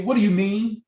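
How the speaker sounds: condescending put-down to a friend who said no; rude, mocking scorn. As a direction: sneer at them like they're stupid. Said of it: "Don't, don't say".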